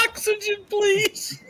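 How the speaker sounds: breathless laugh, high pitch. Real, not acted. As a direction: gasp it out through laughter. Gasping with laughter.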